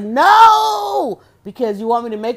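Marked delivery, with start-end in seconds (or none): 0.0-1.2 high, loud refusal; mock loud no. Retelling everyone refusing the Thursday move, loud mock no. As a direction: shout it down. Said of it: "everybody was like".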